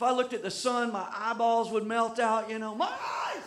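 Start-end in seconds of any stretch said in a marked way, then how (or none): 2.7-3.4 high, soft mock wail; mock cry of pain. Joking about his glasses, a mock squeal of pain. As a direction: squeal it like your eyes are melting.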